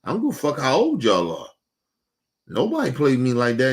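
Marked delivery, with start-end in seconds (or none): none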